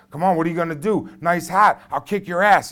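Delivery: high, loud bully yell right in his face; screaming taunts. Retold or imitated. Bully goading a kid into a fight, loud taunting yell. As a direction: taunt him at full shout to start a fight. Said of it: "he's screaming in my face".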